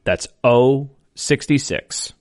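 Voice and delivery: slow, drawn-out number calling; bingo caller. Acted. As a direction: call each number slow and clear.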